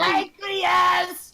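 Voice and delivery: loud laughing shout. Real, not acted. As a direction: yell it out with a laugh. Shouted joke interjection.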